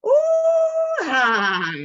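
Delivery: very high, loud whinny; imitated horse whinny; horse neigh. A narrator's reading. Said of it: "It was a horse".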